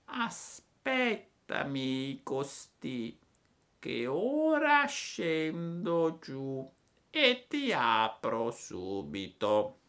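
acuta, lentissima; lumaca flemmatica. A narrator's reading. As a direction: promette senza fretta. La lumaca promette. (la lumaca promette, lentissima)

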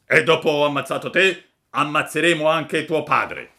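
loud threatening assassin voice; menacing robber. Acted. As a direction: promise to kill his father too. Robber threatens his father.

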